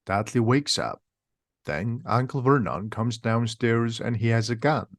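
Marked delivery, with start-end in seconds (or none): none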